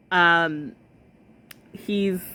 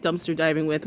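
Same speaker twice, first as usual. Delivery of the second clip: ordinary delivery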